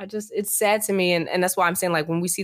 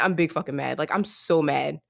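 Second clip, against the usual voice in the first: usual speaking voice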